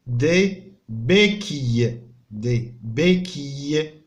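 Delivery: دي بيكي said slowly, each syllable clear; teacher modelling French; slow model word. Shown as a demonstration. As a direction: stretch دي بيكي so the sound is heard. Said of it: "الصوت اللي كتكرر هو اي".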